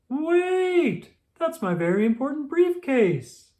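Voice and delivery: put-on high loud shout after the children. A narrator's reading. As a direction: shout after the runaway briefcase. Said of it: "shouted Mister Brown".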